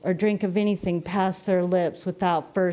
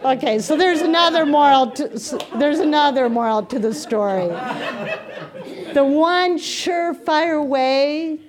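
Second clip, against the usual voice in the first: laughing; 5 semitones higher, 3 dB louder than usual. Real, not acted. Recovering from laughter.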